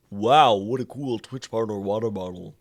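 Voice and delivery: low voice for Jessica. Acted. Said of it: "That's the voice I'm giving you now".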